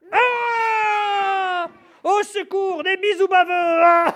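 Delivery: high, loud, comic screams; mock terror screams. The mouse finally gets her big scare from the monster, shrieking high. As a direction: scream for help at slobbery kisses.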